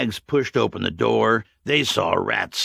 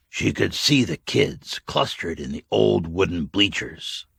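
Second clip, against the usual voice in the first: ordinary delivery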